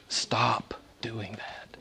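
slow, breathy whisper. Real, not acted. Preacher lovingly tells listeners to stop, whispering. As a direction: hush it like a gentle secret.

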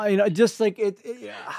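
laughing. Real, not acted.

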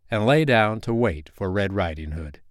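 usual speaking voice

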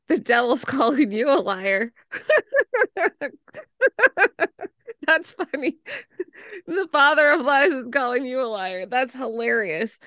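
amused, laughter breaking into the words; real laughing. Real, not acted. Host tickled that the devil calls guest a liar, laughing as she speaks.

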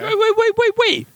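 a friend suddenly cutting in, high and eager; excited interruption. Real, not acted. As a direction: burst in, way up high.

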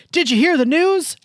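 loud, high call from a window; yelled from a window. Retold or imitated. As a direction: call out to a passerby from afar. Woman yelling from window.

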